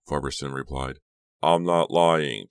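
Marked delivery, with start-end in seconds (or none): 1.4-2.5 loud, drawn-out protest. A narrator's reading. Chuck called a liar by Farberson, protesting loudly. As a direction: protest slowly and forcefully. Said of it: "Chuck protested".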